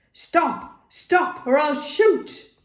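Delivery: high urgent shout. A narrator's reading. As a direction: yell the threat desperately. Doctor aims pistol shouting.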